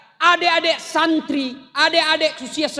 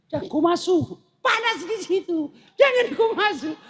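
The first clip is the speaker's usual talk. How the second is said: mild mock warning; 4 semitones higher than usual. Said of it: "bahasa Bugisnya".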